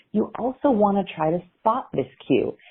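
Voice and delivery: ordinary talk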